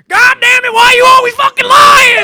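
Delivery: full-force high bellow; mock furious dad. Son imitates dad catching a liar, furious shout. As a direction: explode like an exasperated father, very loud and high. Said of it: "And Dad goes".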